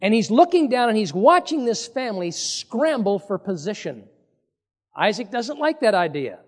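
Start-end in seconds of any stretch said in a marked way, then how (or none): none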